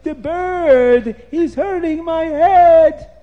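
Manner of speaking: high, loud wails with stretched words; Nimrod screaming in pain. A narrator's reading. Nimrod pecked by the bird, screaming in pain. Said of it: "Nimrod starts to scream".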